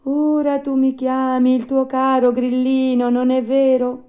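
high, loud little cricket voice; talking cricket, reproachful. Acted. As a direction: reproach the puppet as a small cricket.

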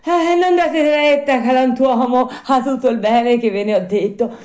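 high, loud panicked puppet voice; scared high-pitched yelling; frightened shouting. Acted. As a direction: cry out a frantic retraction. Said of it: "tutto impaurito, cominciò a gridare".

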